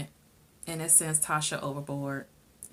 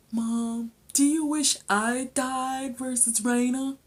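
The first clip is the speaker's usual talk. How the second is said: slow, higher-pitched mock son; imitated needy son; 7 semitones higher, slower than usual. Son asks mom about sister, slow high imitation. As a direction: draw the question out in a high voice.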